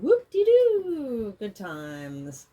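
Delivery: high, loud, slow mock whoop; drawn-out ironic celebration; sarcastic cheer. Sarcastic cheer about Virgo rising.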